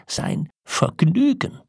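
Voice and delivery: slow, soaring, incredulous echo; outraged disbelief. A narrator's reading. Old man repeats his wife's word, rising in outrage. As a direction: draw it out, climbing high in outrage.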